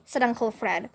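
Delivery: usual speaking voice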